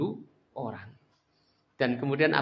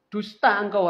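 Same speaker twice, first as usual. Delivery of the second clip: angry retort; 6 semitones higher, 5 dB louder, slower than usual. Acted. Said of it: "nggak mampu menguasai dirinya".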